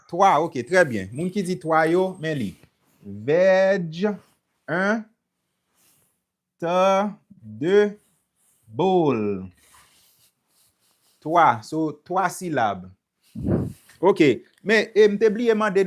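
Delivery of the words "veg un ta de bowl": "Veg", "ta", "bowl" each set apart by pauses; syllable-by-syllable demo. Shown as a demonstration. Teacher counting syllables for learners, word broken apart. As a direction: sound out vegetable piece by piece. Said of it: "konbyen silab li genyen".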